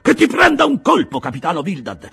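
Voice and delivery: high, loud, angry shouting; furious shout. A narrator's reading. Peleg rages at his partner in the cabin, shouting. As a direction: rage at him.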